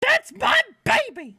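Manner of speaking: hammed-up high yell; mock yell. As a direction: shriek it back at her in mockery. Streamer mocks yelling mother.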